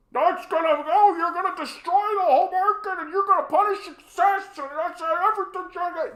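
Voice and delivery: hammed-up alarm about success; mock hysterical critic. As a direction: lampoon the opponent's hysteria.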